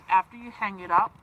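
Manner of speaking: usual speaking voice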